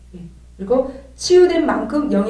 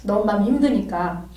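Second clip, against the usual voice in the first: normal talking voice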